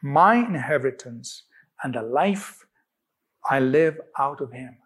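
deliberate, pausing affirmation for others to follow; emphatic declaration. Shown as a demonstration. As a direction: declare it in short, separated phrases. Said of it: "statement I want to emphasize".

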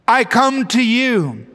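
slow, measured prayer with long pauses; leading a prayer. Acted. Preacher leads viewers in a prayer of repentance, slow and measured. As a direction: pray slowly, phrase by phrase, letting each line fall.

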